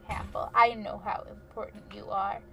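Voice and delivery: ordinary delivery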